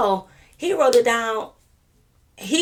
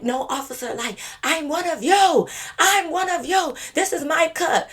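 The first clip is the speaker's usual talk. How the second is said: put-on whiny drunk plea; 10 semitones higher, 3 dB louder than usual. Drunk sister pleading with the officer to keep her cup, high tearful whine. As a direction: beg the officer like a sobbing drunk girl.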